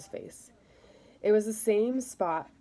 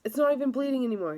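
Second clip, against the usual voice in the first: higher, louder and faster, pushing back; insistent protest; 6 semitones higher, 4 dB louder, faster than usual. Acted. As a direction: rush it out, refusing to give in. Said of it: "Victor continued to protest".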